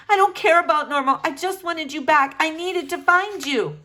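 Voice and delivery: a frightened mother pouring it out; distraught mother. Acted. Mother telling Flora she searched her room, anxious.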